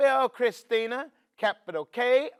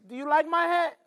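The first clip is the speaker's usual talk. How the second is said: ordinary delivery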